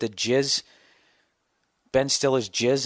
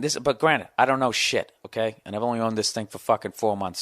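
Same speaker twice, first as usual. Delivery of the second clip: ordinary delivery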